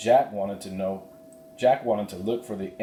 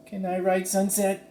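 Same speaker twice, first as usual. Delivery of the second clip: put-on much higher hopeful girl's voice; Annie eager; 12 semitones higher than usual. Annie asking Slim to ride the foal, high eager voice. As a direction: ask like a thrilled kid.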